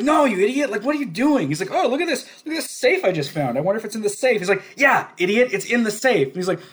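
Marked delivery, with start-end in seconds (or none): 0.0-1.5 high, loud mock exasperation; exasperated outburst. Club owner berating a detective wrecking his bar, exasperated. 1.7-4.4 lightly acted high-pitched fake wonder; feigned ignorance. Detective playing dumb to needle the club owner, mock-curious. 4.8-6.3 high, loud mock impatience; exasperated retort. Club owner telling the detective where it is, exasperated.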